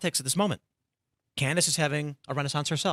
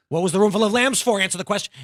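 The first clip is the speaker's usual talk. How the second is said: loud, higher, mock-indignant questioning; mock exasperated demand; 5 semitones higher, 6 dB louder, faster than usual. Host challenging fans of a mystery show, mock exasperated. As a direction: press for an answer in mock exasperation.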